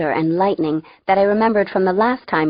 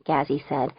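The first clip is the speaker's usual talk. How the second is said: usual speaking voice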